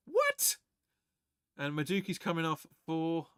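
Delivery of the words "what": sharp, very high squeak of disbelief; a stunned yelp of disbelief; shocked. Real, not acted. Fan stunned by the captaincy news, yelping in shock.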